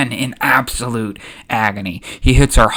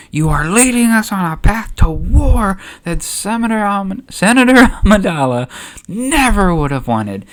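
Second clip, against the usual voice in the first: indignant, raised voice building on the charge; Mon Mothma accusing; 9 semitones higher, 3 dB louder than usual. Acted. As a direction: scold him in high, heated protest. Acted senate quarrel, Mothma accuses.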